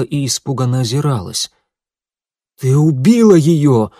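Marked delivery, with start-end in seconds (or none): 2.6-3.9 loud, slow horrified cry; shocked exclamation. A narrator's reading. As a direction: cry out in horror. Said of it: "воскликнул Элиас". (громкое потрясённое восклицание)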